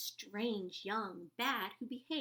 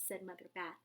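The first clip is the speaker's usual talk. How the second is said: ordinary delivery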